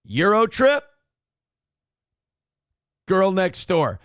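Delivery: high and loud, "Trip" rising, each title set apart by a pause; listing like a sportscaster. Retold or imitated. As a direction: announce the two titles as a sportscaster would. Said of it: "It made me think of this clip".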